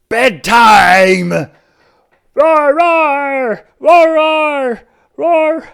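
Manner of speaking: high, loud defiant roaring. A narrator's reading. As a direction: roar against going to bed. Dinosaur fights bedtime.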